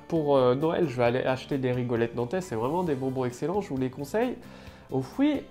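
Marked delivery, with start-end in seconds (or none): none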